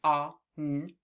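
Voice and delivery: "ah, n" split into sounds; slow segmenting of on; sounding out on. Shown as a demonstration. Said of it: "in the word on".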